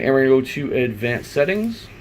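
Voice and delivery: normal talking voice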